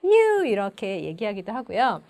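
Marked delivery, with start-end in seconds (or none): 0.0-0.5 high, loud whoop; relieved cheer. Shown as a demonstration. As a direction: whoop as if the tedious task is done. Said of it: "지루한 일을 내가 끝냈을 때". (지루한 일을 끝낸 환호)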